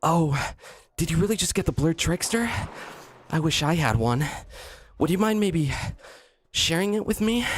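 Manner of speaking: put-on high, timid anime-boy voice; Sykkuno impression. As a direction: play a shy innocent anime boy asking a favour. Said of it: "he talks like how I talk ironically".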